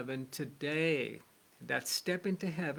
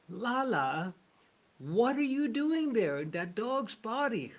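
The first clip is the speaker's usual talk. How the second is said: higher, incredulous storyteller's exclamation; astonished questioning; 6 semitones higher, 4 dB louder than usual. Acted. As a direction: act out the saint's amazed call to Lala. Saint startled to find Lala as dog.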